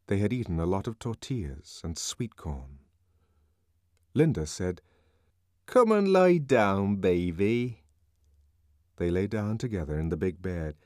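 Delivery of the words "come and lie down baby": lightly voiced mother, coaxing; tender mother. A narrator's reading. Mother calls child to bed.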